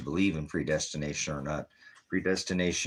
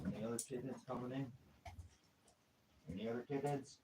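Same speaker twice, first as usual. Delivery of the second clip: high, soft cooing to the kittens; coaxing pet talk; 5 semitones higher, 14 dB quieter than usual. Real, not acted. Owner coaxing kittens inside out of the snow, high soft coo. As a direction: coax the cats in like babies.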